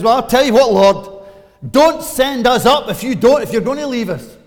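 loud, high, insistent plea to the Lord; pleading Moses. Retold or imitated. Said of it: "Moses says".

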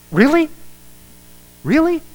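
high, loud disbelieving "Really?" twice; incredulous really. Retold or imitated. Pastor mocking the scoffers' disbelief, incredulous and loud. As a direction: act stunned that anyone could doubt it. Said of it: "I just go".

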